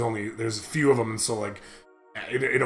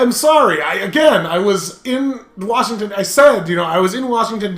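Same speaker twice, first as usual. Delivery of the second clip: raised, louder retold objection; indignant protest; 5 semitones higher, 6 dB louder than usual. Student explains absence to teacher, retold indignant protest. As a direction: plead your case with exasperated emphasis.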